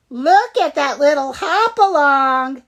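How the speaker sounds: loud jeering bully; mock him like a schoolyard bully; taunting. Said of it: "they taunted Ziggy".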